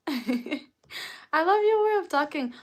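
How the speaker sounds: laughing. Real, not acted. Laughing at viewer's comment.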